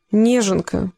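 mild disdain, held in; muttered disdain. A narrator's reading. Young man needling the big man, understated disdain. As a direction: let it out grudgingly. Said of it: "процедил последний участник совещания".

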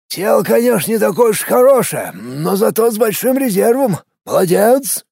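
lightly voiced old mage, pleased; a hint of a satisfied sorcerer; grateful necromancer. A narrator's reading. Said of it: "с благодарностью произнёс маг".

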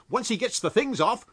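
high, loud urgent warning; loud cry. A narrator's reading. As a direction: warn the others urgently. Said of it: "cried Jaffers loudly".

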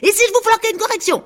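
high, loud barking orders at a crowd; a haughty peacock calling the jostling animals to order; bossy peacock shouting. A narrator's reading. Peacock shouting order at the crowding farm animals, high and loud. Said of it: "faisait le paon".

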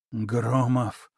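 hoarse dying rasp; wheezing. Acted. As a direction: rasp it out, barely alive. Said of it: "прохрипел он".

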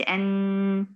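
"أن" with a long hummed ن; extra ghunna. Shown as a demonstration. Teacher showing what not to say, "أن" with prolonged ghunna. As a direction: hold the nasal ن of "أن" too long. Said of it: "بدون غنة زائدة".

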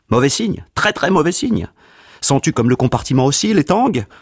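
alarmed. Acted. Man alarmed at the snowstorm.

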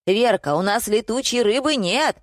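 understated holler; mild booming call. A narrator's reading. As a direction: call it out from the back room. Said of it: "загремело из подсобки".

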